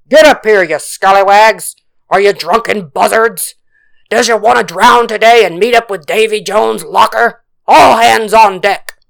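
loud, impatient pirate bellow; captain's roar. A narrator's reading. Impatient captain berates the crew below deck, roaring. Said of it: "They heard the captain's roar".

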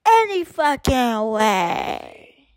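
very slow, stretched and sliding down in pitch; slow drawn-out repeat. Real, not acted. Speaker fed up with cadence competition, slow drawn-out resignation. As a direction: wind down wearily, dragging each word.

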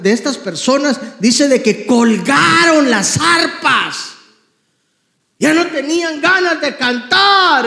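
loud, high, impassioned sermon voice, stretching the sad lines slowly; fervent preaching. Acted. Pastor exhorting the congregation to sing again, full-force preaching. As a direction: cry it out to the congregation like a revival preacher.

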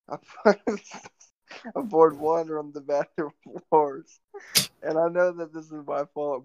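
real laughter breaking up the words; laughing confession. Real, not acted. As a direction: own up through giggles. Laughs while confessing his own fault.

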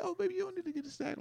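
soft, very high falsetto; mock girlfriend. Girlfriend accepting boyfriend's pampering, soft mock falsetto. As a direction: put on a quiet, cooing woman's voice. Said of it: "She's like".

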